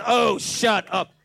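slow, loud and scornful; dismissive God. Retold or imitated. God curtly dismissing the whining pastor, scornful. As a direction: brush him off with contempt. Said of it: "he goes".

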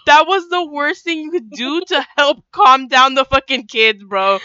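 incredulous rant while cracking up; laughing disbelief. Real, not acted. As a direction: protest through helpless laughs. Cracking up over the retold scene.

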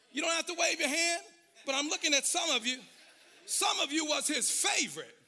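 loud, high whooping sermon over the organ; preaching at full force. Acted. Pastor preaching hard to the church, full whoop. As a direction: preach it out to the congregation.

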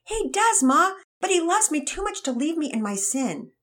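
put-on high, eager boy voice; a gushing young believer; excited teen boy. Todd voiced in read story.

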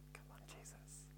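whispered in quiet wonder; awed whisper. Real, not acted. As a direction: breathe it out in awe. Awed aside to himself.

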